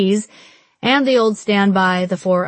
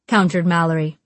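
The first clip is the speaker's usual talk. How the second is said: ordinary delivery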